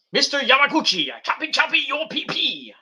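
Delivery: high, sing-song catchphrase; hammed-up wrestling gimmick chant; Mister Yamaguchi impression. Wrestler shouting his old catchphrase in a high sing-song.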